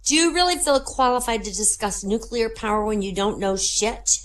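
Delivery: put-on high little-girl voice. Girl challenging the unqualified stranger, high little-girl voice. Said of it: "the little girl says".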